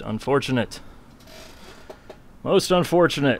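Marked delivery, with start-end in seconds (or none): 2.4-3.4 higher, louder mock lament; mock dramatic. Shown as a demonstration. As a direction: ham up the disappointment. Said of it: "said twice, second louder".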